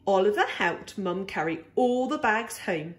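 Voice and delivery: ordinary delivery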